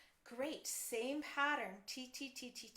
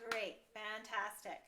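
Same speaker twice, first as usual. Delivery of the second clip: usual speaking voice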